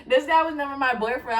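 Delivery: amused disbelief, giggling on the words; retold dying laughing. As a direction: protest broken up by laughter. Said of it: "I start dying laughing because I'm like".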